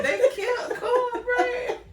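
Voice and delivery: high squeaky mock lament, laughing through it; mock wail. Podcast host mock-mourns Cornbread's movie death, high laughing wail. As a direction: wail it like a grieving fan, hammed up.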